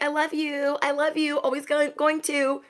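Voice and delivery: high-pitched put-on lovey voice; hammed-up high declaration of love; mock lovey pleading. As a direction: imitate someone pleading their love, pitched well up. Voices the other people's pleading.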